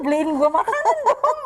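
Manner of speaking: asking for food while laughing. Real, not acted. Recalling begging for real food, laughing. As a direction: giggle through the plea.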